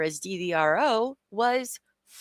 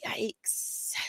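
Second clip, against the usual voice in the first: breathy whispered yikes; 10 dB quieter than usual. Real, not acted. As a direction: say yikes under your breath. Reacting to criminal charges.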